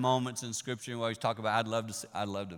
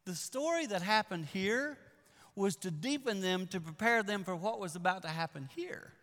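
full-force preaching; 10 semitones higher, 6 dB louder than usual. Acted. Preacher building the story to the church, loud. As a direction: drive the story home to the congregation.

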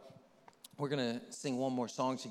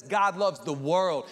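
drawn out and loud; slow proclamation; 7 dB louder, slower than usual. Acted. Preacher proclaiming Jesus' words, slow and strong. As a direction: land each word slowly.

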